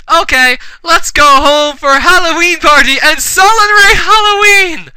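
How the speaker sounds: high, hammy rallying cheer; mock cheer. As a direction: announce it gleefully, last word held high. Characters cheer at story's end.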